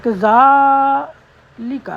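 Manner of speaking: کذالک said slowly with the "za" stretched long; drawn-out za. Shown as a demonstration. Teacher showing the standing zabar, long za. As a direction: hold the "zaa" in کذالک over three counts. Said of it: "کھڑی زبر ہے نا یہ".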